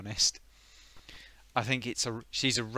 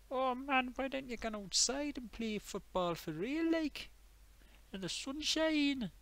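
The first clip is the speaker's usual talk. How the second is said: Geordie parent; 12 semitones higher than usual. Acted. Nagging parent voiced in skit.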